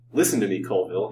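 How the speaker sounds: usual speaking voice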